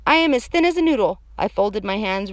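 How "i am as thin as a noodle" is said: put-on loud, high child's protest; high, pushy kid voice; insistent little girl.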